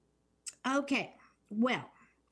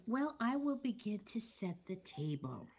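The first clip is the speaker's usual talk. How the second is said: whispering; 4 dB quieter than usual. Pretend. Playful start of the summary.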